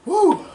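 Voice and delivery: sharp high woo from the burn; high whoop from spicy heat. Real, not acted. Reacting to very hot wing.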